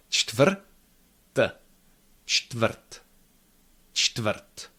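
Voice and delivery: Čtvrt with no vowel between consonants; crisp čtvrt. Shown as a demonstration. Teacher models Čtvrt crisply. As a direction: say Čtvrt tight, no schwa. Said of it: "Nice and crisp".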